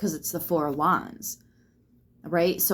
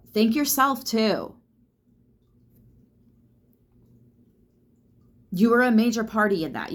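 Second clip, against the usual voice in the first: higher and louder, pressing the point; emphatic; 6 semitones higher, 5 dB louder than usual. Acted. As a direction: insist on it, lift and punch. Urging listeners to self-credit.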